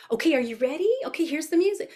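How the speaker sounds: high, eager call to little dancers; lifted, sing-song preschool voice; animated teacher voice. Shown as a demonstration. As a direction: switch into a bright toddler-class cue. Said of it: "going in and out of my adults".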